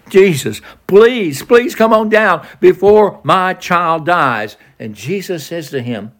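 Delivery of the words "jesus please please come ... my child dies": higher, slow, desperate plea; begging father. Acted. Father on his knees begging Jesus, desperate plea. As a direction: beg on your knees for your son. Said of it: "He's begging him".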